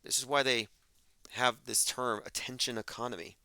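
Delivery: ordinary delivery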